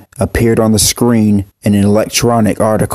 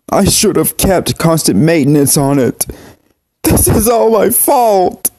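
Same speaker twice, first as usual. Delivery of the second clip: higher, tearful self-blame; crying heavily; 5 semitones higher than usual. Acted. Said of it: "he cried heavily".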